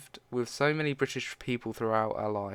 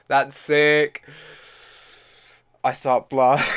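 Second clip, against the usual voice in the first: loud and much higher; thrilled outburst; 5 semitones higher, 12 dB louder, slower than usual. Real, not acted. Narrator thrilled by her own robotic arm gun, loud. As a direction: burst out in delight.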